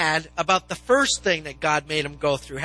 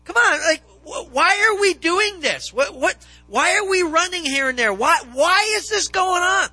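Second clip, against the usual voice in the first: much higher, louder exasperated protest at God; exasperated complaint; 11 semitones higher, 5 dB louder than usual. Pretend. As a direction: play a fed-up Joseph throwing his hands up. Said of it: "if I was Joseph, I'd be like".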